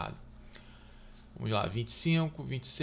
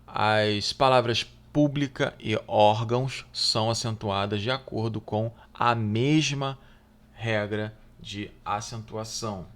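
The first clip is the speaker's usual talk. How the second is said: louder, deliberate read of the test question; reading the exam item; 5 dB louder than usual. Acted. Teacher reading the exam question aloud to students, deliberately. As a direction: read the question out clearly to the class.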